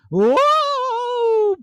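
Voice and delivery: very high startled cry as the king faints; high fainting whoa. Acted. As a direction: squeal and faint like a frightened king. King puppet faints at dragon.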